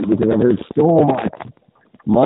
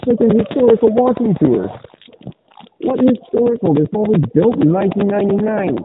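talking through a sweater; muffled, like through a sweater; 8 semitones higher than usual. Real, not acted. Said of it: "you also kinda sound like you're partially muffled".

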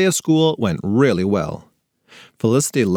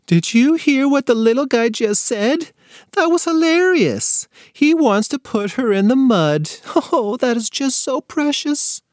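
much higher, gushing voice; amused relative voice; 12 semitones higher than usual. A narrator's reading. Uncle gushing to the family about the toddler, high and amused. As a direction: coo over the cute little guy.

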